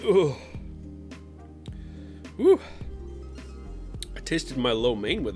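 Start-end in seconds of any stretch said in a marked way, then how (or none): none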